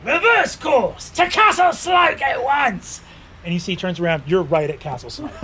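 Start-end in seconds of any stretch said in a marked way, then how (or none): 0.0-3.0 high, loud knightly order; pompous knight bellowing orders; shouted command. Acted. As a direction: shout it drawn out, pitched way up.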